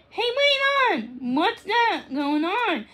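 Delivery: high, startled kitten voice; surprised kitten. Acted. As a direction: sound startled, like a kitten caught off guard. Max notices trouble.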